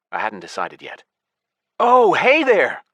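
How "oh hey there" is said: fake cheerful. Hero plays cheerful innocent.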